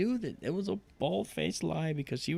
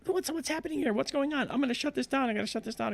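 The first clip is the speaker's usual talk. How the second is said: high-pitched mock panic, much higher throughout; retold panicky outburst, pitched way up; imitating panic; 13 semitones higher, faster than usual. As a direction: act out her alarm in a high, urgent voice. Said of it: "she saw this and she's panicking".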